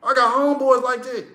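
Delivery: normal talking voice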